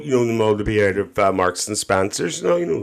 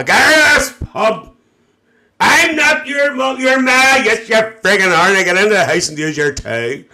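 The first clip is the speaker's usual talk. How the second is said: screaming pub row, much higher and louder; EastEnders shouting match; 10 semitones higher, 5 dB louder than usual. Acted. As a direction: bellow like a soap-opera mother throwing someone out. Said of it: "Lillian Mitchell".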